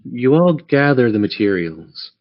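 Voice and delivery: usual speaking voice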